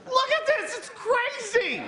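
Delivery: high, slow, wide-eyed giraffe voice; excited giraffe. Acted. Giraffe calling down to the horse, high and amazed. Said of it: "giraffe and horse dialogue".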